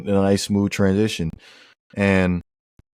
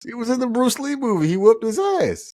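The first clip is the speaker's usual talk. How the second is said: high-pitched protest; 12 semitones higher than usual. Real, not acted. Co-host insisting Bruce Lee beat Chuck Norris, high-pitched protest. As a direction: voice shooting way up in disbelief.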